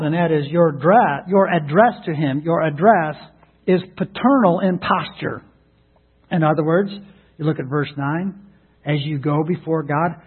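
ordinary talk

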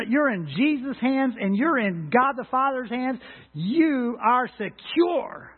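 full-force sermon delivery; preaching. Acted. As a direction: preach it out to the congregation with conviction. Preaching scripture and assurance.

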